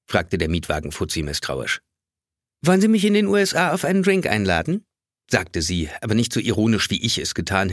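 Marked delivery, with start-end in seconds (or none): 2.6-4.9 high, flirty teasing question; coquettish. A narrator's reading. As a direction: flirt with the clerk, lightly coy. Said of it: "mit einem koketten Augenaufschlag".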